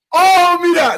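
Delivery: high, loud delighted cry; excited shout. Real, not acted. Spotting the song she prepared, an excited high shout. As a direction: yell it out in surprise.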